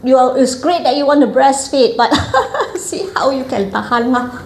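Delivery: high-pitched mock congratulations; midwife's congratulations. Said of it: "the midwife who was in charge of the class said".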